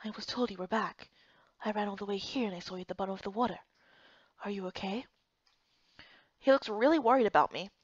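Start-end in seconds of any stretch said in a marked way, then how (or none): 0.0-5.1 much softer, gentle and concerned. Acted. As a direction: speak softly to someone you just rescued. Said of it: "such a soft voice".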